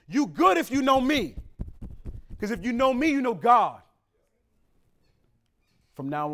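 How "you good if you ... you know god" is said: preaching emphasis. Acted.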